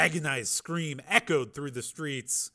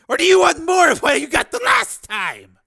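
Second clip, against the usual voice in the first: high, loud, snarling order; furious snarl; 15 dB louder than usual. Acted. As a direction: snarl it at full force to dominate her. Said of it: "he snarled".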